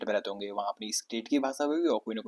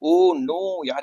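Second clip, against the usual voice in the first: higher, louder mock dismay; mock exasperated oh no; 5 semitones higher, 6 dB louder, much slower than usual. Teacher acting tired of a repeated line, mock dismay. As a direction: groan it in fake despair. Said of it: "ये फिर इस तरीके का".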